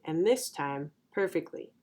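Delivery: ordinary delivery, nothing marked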